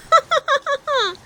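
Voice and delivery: put-on high, loud childish giggle. A narrator's reading. Piglet giggling after splashing, high.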